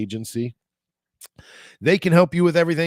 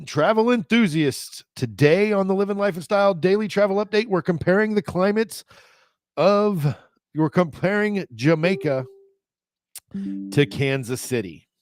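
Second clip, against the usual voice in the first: lightly raised, brisk announcer voice; a touch of TV weather presenter; announcer read; 7 semitones higher than usual. Acted. Vlogger opening his travel weather segment, mildly announcer-toned.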